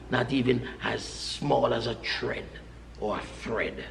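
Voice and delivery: softening until barely audible; fading to a hush. Real, not acted. Preacher hushes the congregation on the curse, fading soft. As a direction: let it fade to almost nothing. Preacher drops to near whisper.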